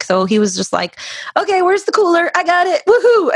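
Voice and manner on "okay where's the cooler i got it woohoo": high, whooping glee; excited. Retold or imitated. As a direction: cheer like a party guy. Quoting dad finding beers.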